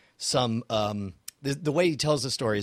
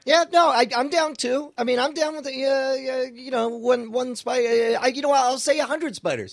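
squeaky bravado; 12 semitones higher, 5 dB louder than usual. Pretend. Bravado about accepting spiders.

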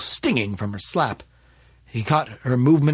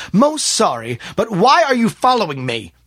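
raised, firm courtesy; polite but stern; 8 semitones higher, 6 dB louder than usual. A narrator's reading. Smith confronting the man following him, polite but stern. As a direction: challenge him loudly but keep it civil. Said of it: "His voice was polite but stern".

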